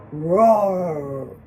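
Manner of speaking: low, growling mock roar; hammed-up storybook lion roar. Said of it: "growls the lion pack".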